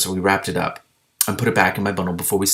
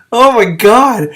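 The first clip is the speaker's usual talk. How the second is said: shocked exclamation; 13 semitones higher, 12 dB louder, much slower than usual. Real, not acted. Reader's exclamation.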